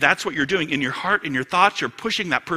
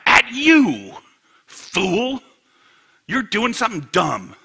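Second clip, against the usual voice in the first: raised-pitch angry jab; acted anger; 7 semitones higher, 6 dB louder, slower than usual. Angry person telling someone off, acted outburst. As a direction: lash out loud, then ease slightly. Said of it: "I'm angry".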